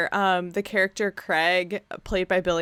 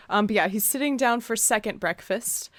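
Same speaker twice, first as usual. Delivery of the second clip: ordinary talk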